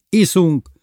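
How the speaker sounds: lifted, lively cheerful listing. A narrator's reading. Lili rallies the drinking company, cheerful and high. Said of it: "mondta derűsen Lili".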